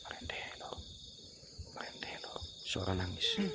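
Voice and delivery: very faint, near-whisper; hushed listening. Real, not acted. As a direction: breathe it out while straining to hear. Listening to moaning sound. (berbisik pelan)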